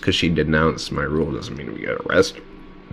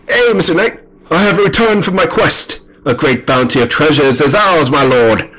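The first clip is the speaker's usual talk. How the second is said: high, proud heraldic proclamation; returning knight; 10 semitones higher, 6 dB louder than usual. Acted. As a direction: report to your lord in a hammed-up knight voice. Voices knight returning from quest.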